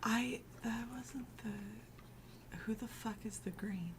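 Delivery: hushed whispered aside; whispering. Real, not acted. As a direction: drop to a whisper.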